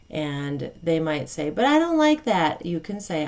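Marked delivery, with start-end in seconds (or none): 1.5-2.6 put-on higher, louder protesting child; whiny child protest. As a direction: mimic a child complaining.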